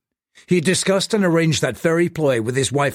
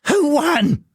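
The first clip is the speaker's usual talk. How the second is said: desperate gasp; 4 dB louder than usual. Acted. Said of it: "Nine Carat Chew gasped".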